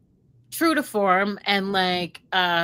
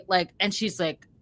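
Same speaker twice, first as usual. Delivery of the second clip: usual speaking voice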